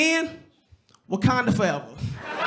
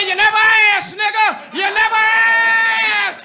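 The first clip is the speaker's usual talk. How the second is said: shouting it at him, higher and louder; loud high retort; 8 semitones higher, 6 dB louder, slower than usual. Retold or imitated. Wife yells back at her husband, loud and high. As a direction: throw it back in his face.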